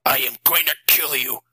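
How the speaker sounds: understated furious threat; the father threatening the vampire, mildly angry. A narrator's reading. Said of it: "Matt shouted. His voice was deeper than normal".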